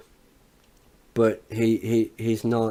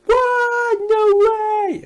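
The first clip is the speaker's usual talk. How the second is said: much higher, louder cry of disbelief; shocked crowd; 8 dB louder, much slower than usual. Retold or imitated. Imitating the shocked crowd at the kick-out, high and loud. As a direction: squeal it like a stunned crowd. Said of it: "Everyone's like".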